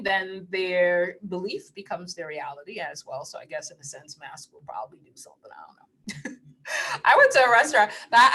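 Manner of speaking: normal talking voice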